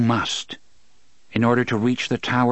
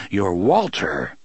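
usual speaking voice

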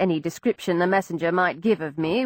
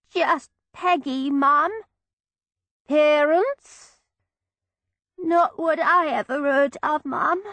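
put-on tearful Cockney girl, higher pitched; broad Cockney accent; 7 semitones higher, slower than usual. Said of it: "In the broadest Cockney accent I could manage, with a sniffle".

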